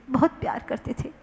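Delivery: quick whispered repeat of बहुत प्यार करते थे; whispered echo. Shown as a demonstration. Preacher echoes the loving uncle line, whispered. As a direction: breathe बहुत प्यार करते थे fast and soft. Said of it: "repeated as a whisper".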